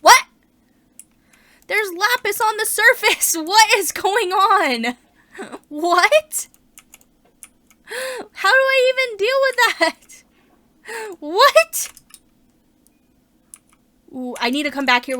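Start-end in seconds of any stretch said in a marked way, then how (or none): 0.0-11.9 loud, high, amazed outburst with laughs; can't believe her eyes, near squealing; loud stunned squeals of what; shocked disbelief. Real, not acted. Shocked by lapis on surface.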